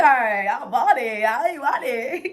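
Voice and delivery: playful. Real, not acted. Joking about motivation speaker.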